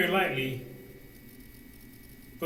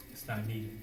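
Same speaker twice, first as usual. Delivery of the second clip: low, very soft inner voice; hushed, lowered voice of conscience; small quiet voice; 8 semitones lower, 12 dB quieter than usual. Acted. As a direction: say it softly, beneath the noise. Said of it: "And the small voice beneath it says".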